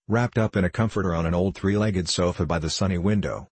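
ordinary delivery, nothing marked

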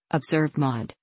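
ordinary delivery, nothing marked

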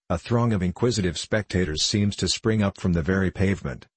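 ordinary delivery

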